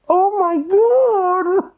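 very high, drawn-out wail; acted horror. Storyteller reacting to the partner's admission, wailing high. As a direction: stretch it into a mock-horrified wail.

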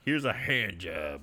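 low, slow, soft pun delivery; mock villain one-liner. Co-host quotes the killer gnome's pun, low mock one-liner. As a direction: drop the voice and drag out the one-liner.

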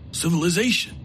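the injured gunman, resentful; wounded outlaw voice. A narrator's reading. Coakley's answer in his voice.

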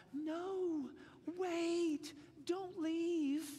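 high, drawn-out fake plea; hammed-up pleading, pitched high; mock pleading. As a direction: pretend to beg them to stay. Sarcastically voices Jesus begging followers.

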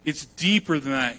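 ordinary talk, nothing marked

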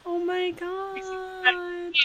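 high, slow gush over a sweet ending; swooning reaction, high and slow. Real, not acted. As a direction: melt at the story, drawn out and high.

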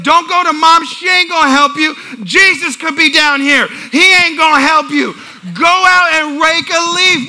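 high, loud dad rant; fed-up parent hollering at kids; exasperated scolding. Retold or imitated. Dad to sons running to mom, exasperated scolding.

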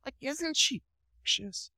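put-on cooing baby talk.

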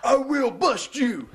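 high, slow hammed-up lawman voice; mock lawman threat. Deputy sheriff Gordy threatening a wrestler, hammed up. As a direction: drag out the threat like a cartoon sheriff. The Law threatening, acted quote.

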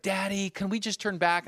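high-pitched pleading daughter; frustrated child. Retold or imitated. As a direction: imitate a fed-up kid begging Dad. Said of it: "she's getting frustrated".

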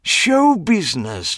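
high, slow, savoring words; grand showman. A narrator's reading. Clive proclaiming show business, high and slow. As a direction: roll it out like a marquee.

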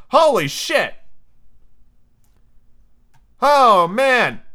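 high, loud disbelief; real astonished outburst; shocked reaction. Real, not acted. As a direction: blurt it out, stunned by the twist. Reader shocked at Wendy being shot.

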